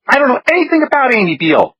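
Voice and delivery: put-on high, frantic panicked girl; hysterical. A narrator's reading. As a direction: panic rising, pitch high. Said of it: "a tad more hysterical".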